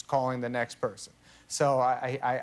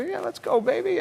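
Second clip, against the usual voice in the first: pitched high, hammed-up enthusiasm; mock eager; 6 dB louder than usual. Caving to Nicholson and leaving with him, mock eager and high. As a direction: bounce up into fake excitement. Gives in and follows Jack.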